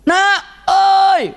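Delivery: put-on loud, high shout for a child; acted mother's piercing call; hollering. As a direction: holler at your kid across the house.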